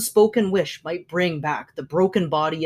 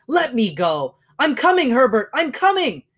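high, loud straining shout; desperate shout; 7 semitones higher, 5 dB louder than usual. A narrator's reading. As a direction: call out while struggling.

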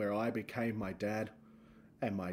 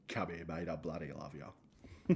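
gruff loving dad; 7 semitones lower, 5 dB quieter than usual. Retold or imitated. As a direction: drop deep and rough like a proud old Aussie dad. Dad's gruff loving reply.

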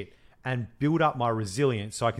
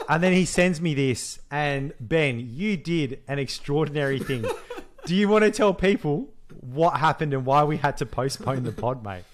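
ordinary delivery

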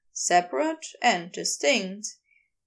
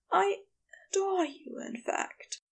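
higher, fading into a whisper; gentle angel, hushed and adoring; tender Aziraphale voice; 8 semitones higher, 4 dB quieter than usual. A narrator's reading. Aziraphale speaks to Crowley.

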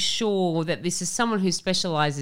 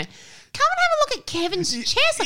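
much higher, louder, laughing flirty mum voice; mum calling out; 5 dB louder than usual.

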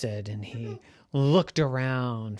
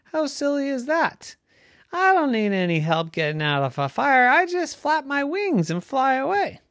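high-pitched scoff at a silly idea; dismissive parent; 11 semitones higher, 5 dB louder than usual. A narrator's reading. Parent duck tells Ducky the plan is silly, scoffing. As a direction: brush the danger off, pitched up.

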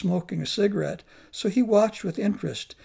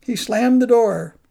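plain reading voice

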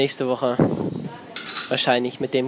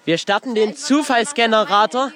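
excited announcing; 7 semitones higher, 3 dB louder than usual. Real, not acted. Counting down, announcing loudly.

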